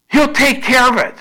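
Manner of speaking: preaching shout. Real, not acted. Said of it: "repeated, loud".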